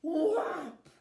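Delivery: very loud sudden smack of a word; loud impact word; loud whop. Acted. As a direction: bring the paw down with a bang. Bear's paw slams down.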